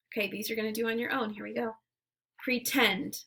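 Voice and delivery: normal talking voice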